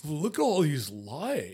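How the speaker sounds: slow, dopey voice of wonder; mock dumb-guy marveling; dumbfounded Jethro. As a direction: imitate a dim man gawping in amazement. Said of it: "looking dumbfounded".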